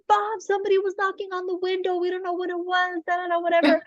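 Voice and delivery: high, mock-frantic kid voice; retold upset children. Frightened daughters reporting the window knocking, mock frantic. Crying girls' retold complaint.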